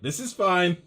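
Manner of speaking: high, loud mock-calm voice; mock calm puffs. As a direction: play it cool while everything burns. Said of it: "puffs be like".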